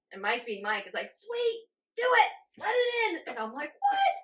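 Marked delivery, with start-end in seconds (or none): 1.1-3.3 high, loud excited urging; imitating eager husband. 3.8-4.2 very high, loud squeal of disbelief; shocked what. Retold or imitated. Shocked reaction to husband.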